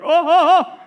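high, loud "oh" of someone falling; imitated falling cry. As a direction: mimic dad's startled yelps as he falls. Imitates dad falling off scaffolding.

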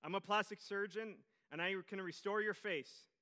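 loud, high call through a closed door; calling loudly. A narrator's reading. Surgeon calling loudly through the door to the hidden husband. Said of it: "he called loudly".

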